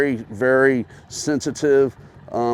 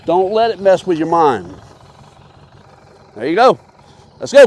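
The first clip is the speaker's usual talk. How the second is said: calling out; 4 semitones higher, 7 dB louder than usual. Real, not acted. Coach calling encouragement.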